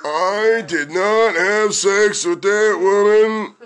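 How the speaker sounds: very slow, deliberate politician's denial; Bill Clinton. Acted. Comic parodying the famous denial, slow presidential impression. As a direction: draw out each word like a president. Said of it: "good Bill Clinton impression".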